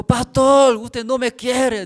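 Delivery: very loud, high, slow self-pitying cry; drunken wailing complaint. Retold or imitated. Drunk brother crying out to the pastor that he isn't loved, loud and slow. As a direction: whine it at full volume like a hurt drunk.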